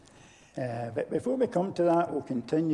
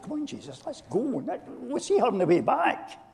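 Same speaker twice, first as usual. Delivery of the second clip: urgent plea; 7 semitones higher than usual. Retold or imitated. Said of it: "he must have said".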